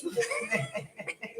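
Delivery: a real high-pitched burst of giggling; high giggle. Real, not acted. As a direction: break into a real high laugh. Laughs at ex's demand.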